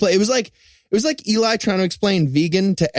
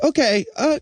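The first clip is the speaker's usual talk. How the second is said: high-pitched wise man; 8 semitones higher, faster than usual. Acted. Wise men questioning the king.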